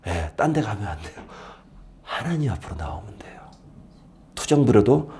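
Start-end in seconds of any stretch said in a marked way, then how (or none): 0.1-3.4 whisper. Real, not acted. Pastor softly urging congregation.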